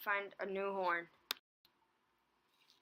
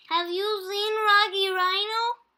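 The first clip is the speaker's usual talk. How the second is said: high, loud chirpy little voice; Teeny's voice; 13 semitones higher, 14 dB louder than usual. A narrator's reading. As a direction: squeak it out brightly. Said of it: "Teeny asked".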